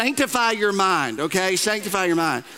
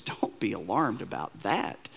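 much softer, low and slow; calm them like Jesus would; hushed reassuring Jesus quote; gentle reassurance; 10 semitones lower, 8 dB quieter, slower than usual. Acted. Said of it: "Jesus is speaking to and says".

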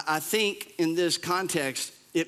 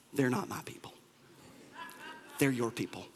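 sheepish Moses; 7 semitones lower, 9 dB quieter than usual. Pretend. Moses sheepishly talks back to God.

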